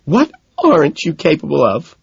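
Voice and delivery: high, acted tearful outburst; hammed-up tearful girlfriend; sobbing. A narrator's reading. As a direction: wail it as if sobbing.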